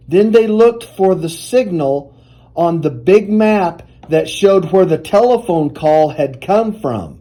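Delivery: plain reading voice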